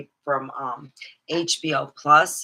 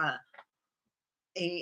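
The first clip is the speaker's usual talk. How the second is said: usual speaking voice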